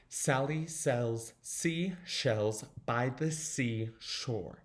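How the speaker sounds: slow "Sally sells seashells by the seashore" with prominent S sounds; S's standing out. Shown as a demonstration. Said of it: "make those S's stand out".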